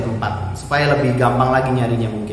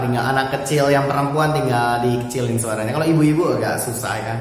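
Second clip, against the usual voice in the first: ordinary talk, nothing marked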